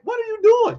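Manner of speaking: high incredulous "what are you doing"; exasperated disbelief. Real, not acted. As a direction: demand what they're doing, baffled. Exasperated at those people.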